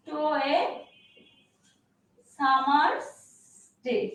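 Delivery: each word spaced out with long pauses; word-by-word dictation to the class; slow dictation. Shown as a demonstration. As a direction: dictate word by word so students can write. Dictating sonnet line word by word.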